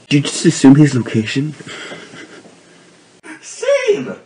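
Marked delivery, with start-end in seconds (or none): 3.4-4.1 high squeal. Pretend. High crying exclamation.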